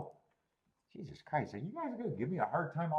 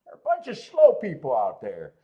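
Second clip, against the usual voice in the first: loud; 10 dB louder than usual. Real, not acted. Exasperated remark on chat.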